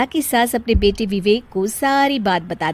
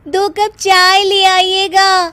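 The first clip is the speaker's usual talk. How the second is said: much higher, louder, slow drawn-out call; 10 semitones higher, 5 dB louder, slower than usual. Acted. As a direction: call lazily from the other room. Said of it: "अपने कमरे से आवाज देती है". (कमरे से सास को पुकारते हुए, ऊँची खिंची आवाज़)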